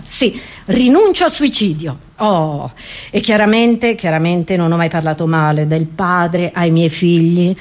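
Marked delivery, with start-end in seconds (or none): none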